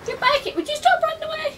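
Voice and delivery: high excited calling. Real, not acted. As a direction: shout after the runner. Chasing fleeing enemy, calling out.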